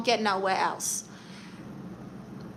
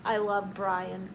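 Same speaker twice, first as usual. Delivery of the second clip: normal talking voice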